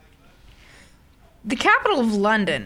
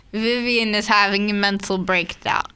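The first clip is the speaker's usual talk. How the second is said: usual speaking voice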